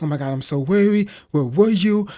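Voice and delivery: high, loud frantic worry; panicked mom. Retold or imitated. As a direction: gasp it out in relief and panic. Mom panicking over missing son.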